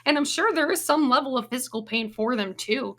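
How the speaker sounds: normal talking voice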